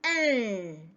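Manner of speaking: "N" said as a long hummed nnn sound; letter sound. Shown as a demonstration. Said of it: "We sound it as".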